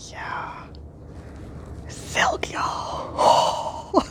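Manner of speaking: hushed, breathy whisper of awe; awed whisper. Real, not acted. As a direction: whisper it like a treasure. Reverent over silk yarn.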